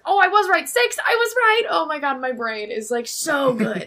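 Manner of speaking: high, loud burst of delight; gleeful self-congratulation at full volume; real triumphant excitement. Real, not acted. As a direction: shout it out, thrilled to be right. Delighted her count was right.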